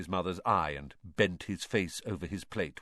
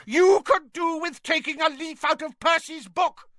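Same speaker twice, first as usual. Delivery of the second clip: high, loud furious yell; 10 dB louder than usual. A narrator's reading. Mother yelling at Fred, jabbing his chest, loud and high. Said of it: "yelled Missus Weasley".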